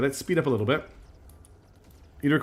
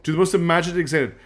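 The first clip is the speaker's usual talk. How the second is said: normal talking voice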